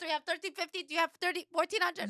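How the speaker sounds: high-pitched auction bid calling; auctioneer. Acted. First-time auctioneer showing how she called bids, high auctioneer patter. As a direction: keep the bids rolling, pitched well up. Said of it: "I was gonna be really going".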